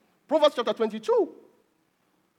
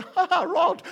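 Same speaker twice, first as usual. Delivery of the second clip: high mock laugh on the word; mock reaction; 9 semitones higher, 4 dB louder, slower than usual. Preacher mimicking people who hear of the rod, mock laughing. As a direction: imitate people pouncing on a word, higher voice.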